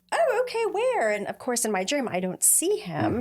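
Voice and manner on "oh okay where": startled surprise. Retold or imitated. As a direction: jump up in pitch, caught off guard. Startled question in dream.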